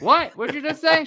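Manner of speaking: high, loud startled what; feigned outrage at her swearing; shocked dad. Retold or imitated. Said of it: "I'm like".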